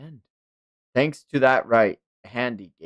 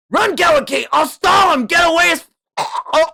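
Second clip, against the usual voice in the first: much higher, louder yell to flee; desperate shout; 7 dB louder than usual. Acted. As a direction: scream at him to run, then choke off. Hero shouts warning, then stabbed.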